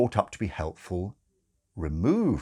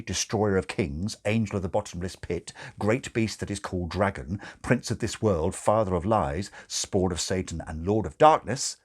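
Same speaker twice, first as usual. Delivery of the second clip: usual speaking voice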